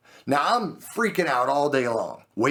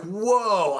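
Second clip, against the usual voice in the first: high, stunned reaction; mock-stunned, pitched up; shocked; 7 semitones higher than usual. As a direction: replay your shock at the news. Retold shock at the news.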